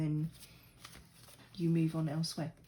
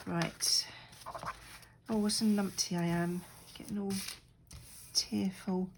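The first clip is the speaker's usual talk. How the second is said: ordinary talk, nothing marked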